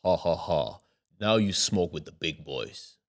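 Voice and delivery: low, slow demonic taunt. Retold or imitated. Friend turned demon taunts him in the car, demonic. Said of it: "his voice changed".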